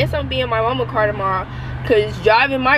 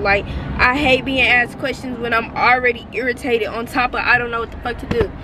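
ordinary talk, nothing marked

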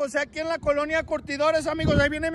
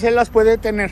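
ordinary talk

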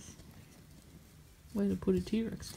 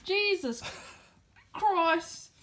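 startled exclamation; 9 semitones higher, much slower than usual. Pretend. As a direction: jump at the sudden shock of it. Model piece comes apart.

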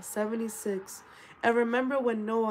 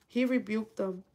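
normal talking voice

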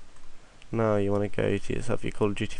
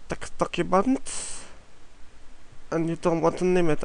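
goofy raised voice, half to himself; odd put-on voice; 7 semitones higher than usual. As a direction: pitch it way up, stretching the words. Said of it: "I don't know why I'm talking like this".

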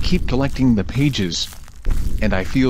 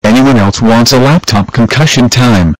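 angry; 7 dB louder than usual. Acted. Fred threatens everyone.

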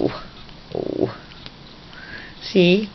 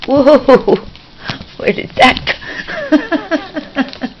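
startled whoa; 13 semitones higher, 7 dB louder, much slower than usual. Real, not acted. Startled by bird foot.

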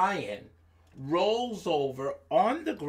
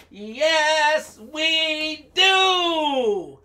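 loud, high, drawn-out storybook calls; calling animals; 7 semitones higher, 8 dB louder, much slower than usual. Acted. Monkey calling to animals holding the sky, hollering. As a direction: holler each line slowly for the animals.

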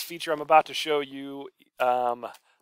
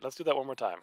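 ordinary talk, nothing marked

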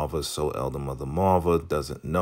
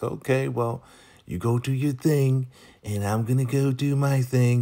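high, put-on sign-off voice; imitated polite goodbye; 7 semitones higher than usual. Joan leaving the couple, high imitated brush-off. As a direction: pitch it up to wrap up the chat.

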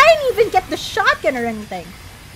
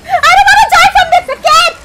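much higher and louder, yelled through laughter; half laughing, half screaming in disbelief; laughing shout; 11 semitones higher, 10 dB louder than usual. Real, not acted. Streamer dying again to the same enemy, laughing yell.

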